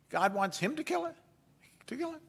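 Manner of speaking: puzzled disbelief, rising high at the end; incredulous questioning. Acted. Preacher voices the story's tension over Isaac, incredulous. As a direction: question it softly, voice climbing in disbelief.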